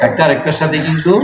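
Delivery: ordinary talk, nothing marked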